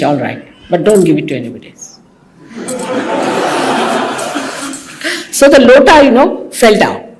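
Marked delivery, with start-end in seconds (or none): 2.6-4.8 a quiet, airy laugh under the breath; soft breathy laugh. Real, not acted. Embarrassed at being recorded, a soft airy laugh. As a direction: laugh it off lightly, half embarrassed.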